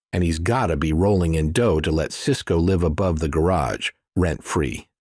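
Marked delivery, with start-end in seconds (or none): none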